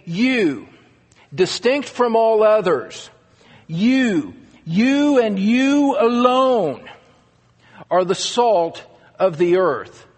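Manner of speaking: each "you" pressed hard, slowing on "you and you alone"; single out the listeners with every "you"; emphatic you. Shown as a demonstration. Preacher telling disciples they alone matter, emphatic. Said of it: "is very emphatic".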